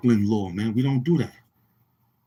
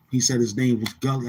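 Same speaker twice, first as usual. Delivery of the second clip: ordinary delivery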